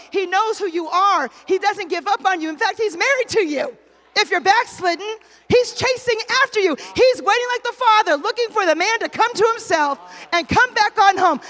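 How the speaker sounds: building from firm to loud and high; impassioned preaching. Real, not acted. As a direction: press each promise on the congregation with rising force. Preaching builds on God's love.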